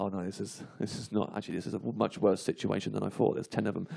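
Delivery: hushed, dawning dread; quietly realising it's bad; scared inner voice. Teller recalling his fear on the bus, hushed dread. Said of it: "I was just really like".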